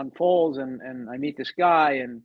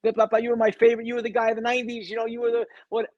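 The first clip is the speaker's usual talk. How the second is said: lightly voiced eager fan, higher; imitating young fans; 7 semitones higher than usual. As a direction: gush like an adoring kid fan, half-mimicked. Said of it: "from kids, you know, writing to me".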